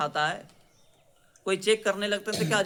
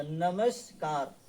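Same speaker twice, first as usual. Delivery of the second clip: slow spelling demo; 2 semitones lower, much slower than usual. Shown as a demonstration. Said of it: "but ऐसे लिखाता नहीं है".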